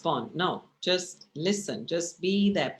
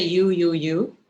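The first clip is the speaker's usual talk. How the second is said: louder jabbing you, you, you; accusing you; 7 dB louder, slower than usual. Shown as a demonstration. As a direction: point the finger with each you. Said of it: "But if you say".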